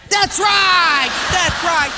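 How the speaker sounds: loud, high, hearty confirmation; triumphant approval. Acted. Personnel guy cheers the Portuguese guy's answer, loud and triumphant.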